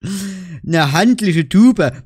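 slow, drawn-out mocking handliche Tube; mock the product text slowly. Real, not acted. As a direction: sneer at the ad phrase. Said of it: "Ist mir doch scheißegal".

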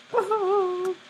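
shrill nervous laugh of fright; high panicked laugh. Real, not acted. Player cornered at night, panicked squeal of laughter.

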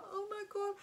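high sobbing wail; hysterical crying. Retold or imitated. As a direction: sob it out high and broken. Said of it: "I was literally hysterically crying".

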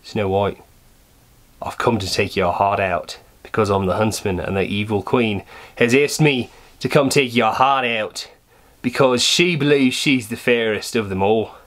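badly faked Australian huntsman; terrible Australian accent. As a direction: act the huntsman in bad Australian. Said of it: "do a terrible Australian accent".

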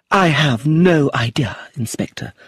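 loud, slow stage delivery; theatrical. A narrator's reading. Actress rebuking the inspector, theatrical and grand. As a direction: play it like a leading lady on stage. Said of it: "stood up theatrically as if she was on stage".

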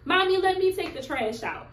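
put-on high, eager little boy; little son begging to help with the trash; son's voice. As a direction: voice her young son offering to help. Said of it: "'cause he was like".

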